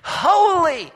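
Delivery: rising, ringing loud cry of holy; excited heavenly creatures calling out. A narrator's reading. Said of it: "they begin calling out and they say".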